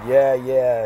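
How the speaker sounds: dismissive grumble. Retold or imitated. Old woman brushing off his nice day, a dismissive mock grumble. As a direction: brush off the greeting. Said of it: "she kind of grumbled and said".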